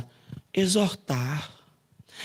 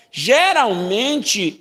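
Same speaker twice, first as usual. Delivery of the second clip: slow stress; 4 semitones higher, 4 dB louder, much slower than usual. Shown as a demonstration. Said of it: "é um tom muito mais raso".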